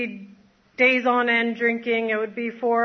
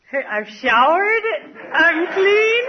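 high, louder, laughing through tears; proud and choked up; real tearful joy at being clean; tearful pride; 6 semitones higher, 5 dB louder, slower than usual. Real, not acted.

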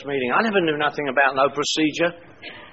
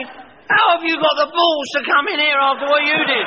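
mock indignant; 10 semitones higher, 3 dB louder than usual. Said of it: "And they'd say".

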